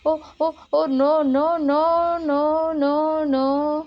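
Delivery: hammed-up panic at the snake; mock alarm. Narrator pretending terror at the toy anaconda, high and loud.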